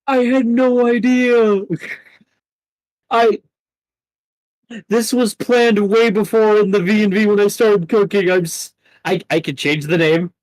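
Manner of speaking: high, loud defensive outburst; real flustered protest. Real, not acted. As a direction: plead innocence loudly, pitch way up. Defends villain's name to player.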